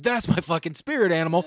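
loud, high thrilled exclaim; delighted. Retold or imitated. Recalling watching Sandman at nineteen, loud thrilled shout. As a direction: cheer him on like a fan at nineteen. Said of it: "And I'm like".

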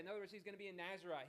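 usual speaking voice

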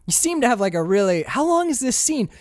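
high, mock-exasperated producer. Producer questioning director Lin, mock exasperated.

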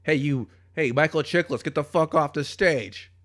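loud, high heckling from the audience; hammed-up heckler shout; acted heckler. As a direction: yell at the comic to get off, then long boos. Acts heckler at open mic.

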